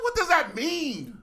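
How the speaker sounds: high, slow, loud bewildered question; baffled. Retold or imitated. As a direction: stretch it out in disbelief. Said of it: "I'm like".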